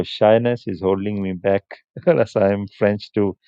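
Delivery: normal talking voice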